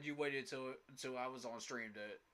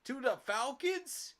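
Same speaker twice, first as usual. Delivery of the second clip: much higher, louder, slowed in disbelief; incredulous; 10 semitones higher, 7 dB louder, slower than usual. Real, not acted. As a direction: repeat it like you can't believe it. Fan incredulous at news.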